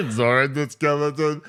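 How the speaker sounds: laughing through the words. Real, not acted. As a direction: giggle it out. Laughing identification.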